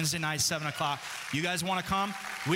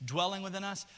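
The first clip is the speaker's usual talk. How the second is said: normal talking voice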